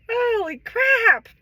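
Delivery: high drawn-out exasperation; exasperated exclamation. Real, not acted. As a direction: drag it out in disbelief.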